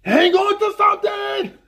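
high, loud mock yell; mock shout. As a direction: yell it like a warning in a movie. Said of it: "Vince Vaughn's like".

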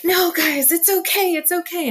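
high, loud soothing plea; begging them not to give up; reassuring pleading. Retold or imitated. To coworkers giving up, a high, loud reassuring plea.

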